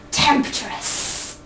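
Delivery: unvoiced, drawn-out sultry whisper; hushed, hammed-up reveal; theatrical whisper. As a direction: whisper it for mock-scandalous effect. Repeated gag word, hammed up.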